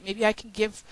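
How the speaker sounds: ordinary talk, nothing marked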